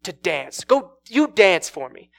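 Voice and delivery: bully them into obeying, each demand punched out; acted angry order. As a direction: play a man losing his temper. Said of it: "he gets angry with her".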